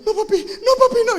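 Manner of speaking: high, loud pleading with a laugh; mock-terrified little kids; scared kids. Kids begging their dad for mercy, high and scared.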